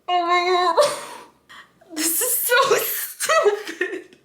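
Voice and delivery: high, slow laugh-through words; laughing embarrassed. Real, not acted. Laughing at her own emotion over Peeta, very high. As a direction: giggle at yourself.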